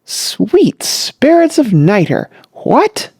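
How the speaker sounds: startled outcry, pitch leaping up; loud cry. A narrator's reading. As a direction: cry out in disbelief. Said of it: "uttered a loud cry".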